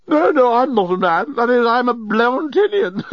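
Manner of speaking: high, tipsy giggly protest; giggling. A narrator's reading. Intoxicated journalist correcting Nettie, giggling. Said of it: "had started giggling".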